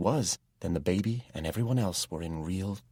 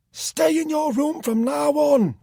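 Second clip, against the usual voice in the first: very high, loud, slow shouted order; shouting; 11 dB louder, slower than usual. A narrator's reading. Jack orders Tom to stay in his room, shouting. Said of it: "Jack shouted".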